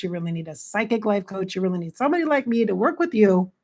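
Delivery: normal talking voice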